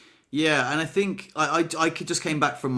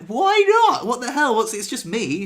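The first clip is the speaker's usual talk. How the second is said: much higher and louder, mock exasperated outburst; exasperated disbelief; 10 semitones higher, 5 dB louder than usual. Retelling telling her shy partner off for refusing French, mock exasperated outburst. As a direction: throw it out in playful disbelief. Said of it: "And I'm like".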